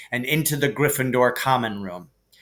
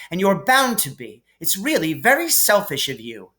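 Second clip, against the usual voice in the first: higher, prim scolding; lightly voiced bossy Hermione; 6 semitones higher than usual. Hermione scolds Harry and Ron, higher and prim. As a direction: tell them off primly.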